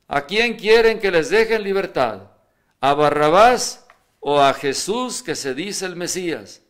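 calling to the crowd. Acted. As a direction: project it out over a crowd. Pilato pregunta a la multitud. (pregunta en voz alta a la multitud)

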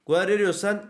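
ordinary delivery

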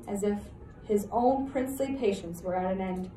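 usual speaking voice

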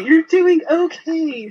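high, drawn-out fake praise; mock encouraging parent. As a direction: coo it slowly like a parent faking approval. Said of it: "if your kid came home with a D".

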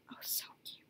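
breathy whispered gush; whispering. Real, not acted. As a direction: whisper it in adoration.

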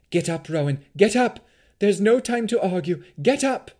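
high, loud, frantic command; fearful urgency. A narrator's reading. Mother urging her fallen daughter to get up, loud frightened urgency. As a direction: plead loudly in rising panic. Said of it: "her mother's voice tremble with fear".

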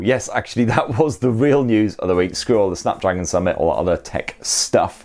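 ordinary talk

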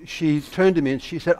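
normal talking voice

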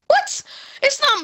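high, loud yelp; indignant surprise. Real, not acted. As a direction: blurt it out high, taken aback. Blamed for the loss.

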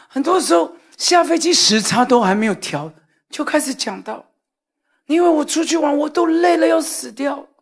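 mild mock argument with wife; heated retelling. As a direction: replay the quarrel, defending yourself hard. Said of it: "越讲越激动".

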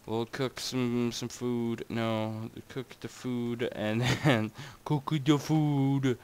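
slow, drawn-out silly voice; odd slow voice. Pretend. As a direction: stretch each word lazily. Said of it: "I don't know why I'm talking like this".

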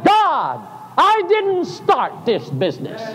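high, ringing exclamations; preacher's high ecstatic cry; crying out to God. Retold or imitated. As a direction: shout the revelation up to heaven.

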